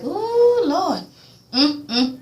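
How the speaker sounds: a high, loud, sung-out "ooh, Lord". Retold or imitated. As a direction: squeal it in mock alarm. Own reaction to Ghost's realization.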